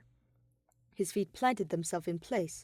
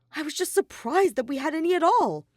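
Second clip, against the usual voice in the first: surprised; 9 semitones higher, 8 dB louder than usual. A narrator's reading. Shuichi defends himself.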